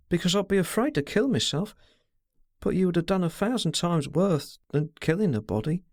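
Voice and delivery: lightly voiced girl, anxious and protesting; pleading girl. A narrator's reading. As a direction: plead her innocence, rising in distress. Girl's voice held.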